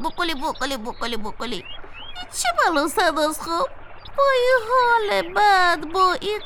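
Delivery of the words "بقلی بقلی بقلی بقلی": turkey gobble. Acted. Turkey hates its own voice.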